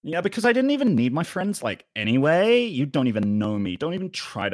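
put-on American teen-girl huff; mock-huffy girl in a fake American accent. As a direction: play a misunderstood American schoolgirl. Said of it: "you sound like an unpopular girl from Kansas".

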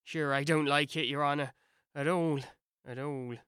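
humble Irish gardener answering his master; Irish servant. Acted. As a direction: Pat the Irish servant, low and unbothered. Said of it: "Alice could only hear whispers".

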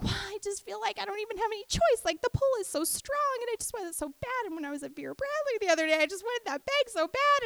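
high, whiny voice of a spoiled want; whiny inner craving. Retold or imitated. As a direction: pout and plead like a greedy child. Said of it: "I can feel like".

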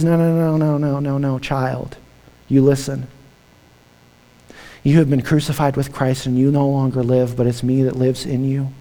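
tender reassurance. Acted. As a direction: soothe a guilty heart softly. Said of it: "And Jesus says".

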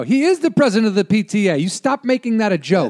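high-pitched scolding mother; mock protective mom. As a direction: play a mom who misses the joke. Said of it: "if your mom is gonna be".

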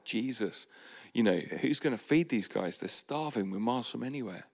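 ordinary talk

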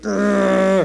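mock frustrated growl. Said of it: "still sighing deeply within himself".

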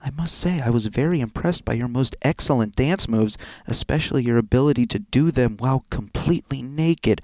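loud, deliberate letter-reading voice; formal, projected read-aloud; blackmail letter reading. Acted. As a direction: read the threat out in measured, emphatic tones.

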